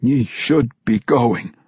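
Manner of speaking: slow, strained dwarf voice; vampire dwarf fighting his hunger; struggling with words. Acted. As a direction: push each word out against the thirst. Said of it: "struggling with every word".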